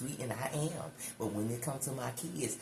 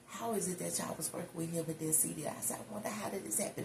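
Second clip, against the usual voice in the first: retold incredulous questioning; 6 semitones higher, faster than usual. Quotes herself questioning the staff.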